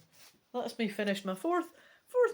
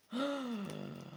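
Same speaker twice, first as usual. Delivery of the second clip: soft mock gasp; 6 semitones higher, 7 dB quieter than usual. Reader reacting to the boat in trouble, gasping. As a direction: draw a quick, quiet breath.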